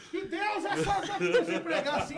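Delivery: high, loud, laughing through the words; laughing disbelief on stage; laughing exclamation. Retold or imitated. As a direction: blurt it out while cracking up. Retelling stage collapse mid-sermon.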